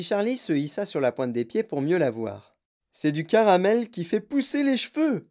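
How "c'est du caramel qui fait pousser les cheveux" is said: excited cry. A narrator's reading. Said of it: "cria Monsieur Wonka".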